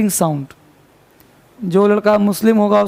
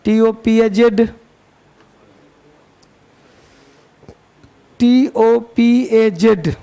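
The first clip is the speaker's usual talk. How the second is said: slow spelling dictation; much slower than usual. Shown as a demonstration. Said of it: "लिखो topaz".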